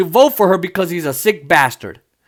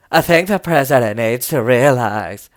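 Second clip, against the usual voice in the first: lower, measured fretful senator; Susan Collins impression; 6 semitones lower than usual. Mocking Susan Collins for viewers, lower and measured. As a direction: lower the voice and sound gravely concerned. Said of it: "that's how she talks".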